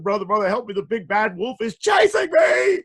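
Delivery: high, loud frantic cry for help; panicked plea. Acted. Pig begging his brother to let him in. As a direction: beg breathlessly for rescue.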